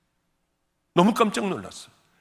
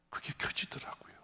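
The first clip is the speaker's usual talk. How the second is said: whisper; 14 dB quieter than usual. Retold or imitated. Hushed telling of night scene.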